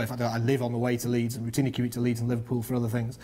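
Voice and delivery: usual speaking voice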